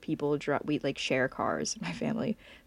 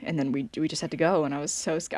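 ordinary delivery

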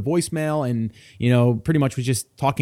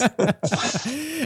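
high laugh; 11 semitones higher, 6 dB quieter than usual. Real, not acted. Host laughs.

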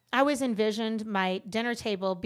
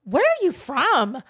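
loud, drawn-out, higher-pitched questioning; mock nosy question; 5 semitones higher, 8 dB louder, slower than usual. Strangers asking where she is from, mock loud questioning. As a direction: imitate a stranger asking, slowly and loudly. Strangers quizzing her, acted out.

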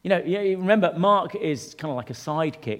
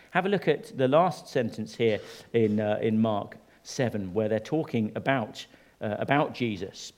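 ordinary delivery

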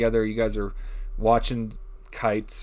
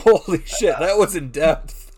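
louder, slow, amazed exclamation; 4 semitones higher, 6 dB louder, slower than usual. Real, not acted. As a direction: marvel at the detail. Amazed reaction to horse description.

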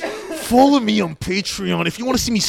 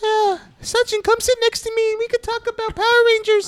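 much higher squeaky helium voice. Pretend. Joking he inhaled gas, squeaky helium voice. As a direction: sound like you sucked helium.

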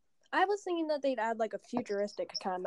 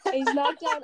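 ordinary delivery

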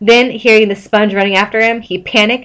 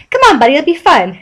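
cheery coaxing; 7 semitones higher, 4 dB louder, faster than usual. A narrator's reading. SpongeBob coaxing Jack.

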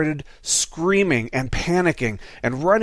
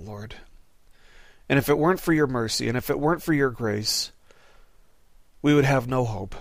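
prayer. Real, not acted. Closing prayer before the sermon.

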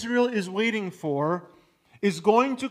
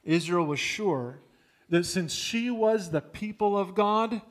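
ordinary delivery

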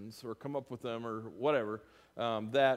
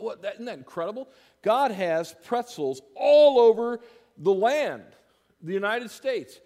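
high, loud, sarcastic wonder; mock amazement; 6 semitones higher, 8 dB louder than usual. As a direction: oversell it, then drop the irony. Ironic preaching crescendo.

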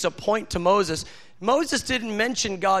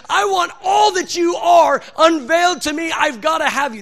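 raised, loud and high, desperate for more; hungry cry; 5 semitones higher, 5 dB louder than usual. Retold or imitated. Moses begging God to unveil everything, hungry shout. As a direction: crave it out loud like a starving man. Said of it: "That's hunger".

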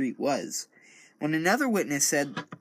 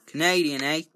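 mock Canadian accent; 3 dB louder than usual. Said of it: "That's, that's not even close".

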